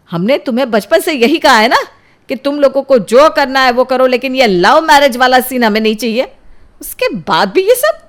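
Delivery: loud, high-pitched scolding; angry shouting mother. Acted. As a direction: lay down the family rule, outraged. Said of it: "उन दोनों की ऐसी चिल्लाने की आवाज़".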